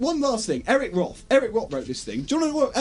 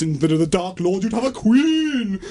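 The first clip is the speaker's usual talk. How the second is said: Galadriel. Acted. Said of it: "She plays an elf".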